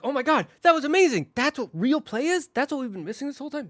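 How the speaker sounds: very high, loud, breathless amazement; gushing toys. Retold or imitated. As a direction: squeal it like toys who just discovered play. Toys gushing over real play.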